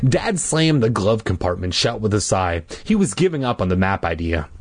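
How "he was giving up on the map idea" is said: ordinary delivery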